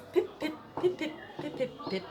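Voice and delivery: dainty footsteps. A narrator's reading. Peanut hurrying off.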